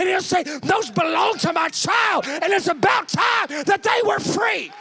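high, shouted preaching that builds to a peak; full-force preaching. Real, not acted. Preacher voicing tearful praise and God's answer, shouted full-force preaching.

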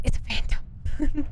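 slow breathy quote; mock spooky line; imitating a friend. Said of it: "Just like how Panda goes".

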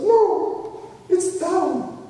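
very high, drawn-out mock wail; imitating crying. Weeping man answers the director after the screening, mock crying. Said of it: "he's crying and crying and crying".

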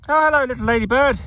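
high sing-song hello to a tiny creature; cooing greeting. Real, not acted. Greets a ladybird he found.

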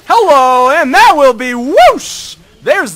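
real hype as the kill lands; excited shout. Real, not acted. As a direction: yell it as you dive in for the kill. Diving onto Jinx mid-fight.

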